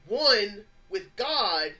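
slow emphasis. Acted. Preacher slows for emphasis.

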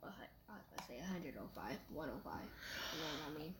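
soft, low, trailing mumble; small low voice, barely projecting; not speaking up. Real, not acted. Boy listing class times to his mom, not speaking up. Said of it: "you're not speaking up".

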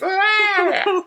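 high hammed-up shriek; mock shriek. Lois reacts, mock scream.

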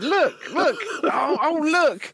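high, gushing pretend wonder; squeal-like pitch, feigning amazement at a sight; mock excitement. Baiting a scammer with a rainbow, in high mock excitement.

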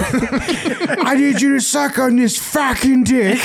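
slow, high put-on British voice; hammed-up British host; mock British accent. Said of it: "shitty British laugh".

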